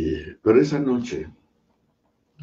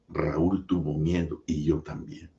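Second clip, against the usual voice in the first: ordinary delivery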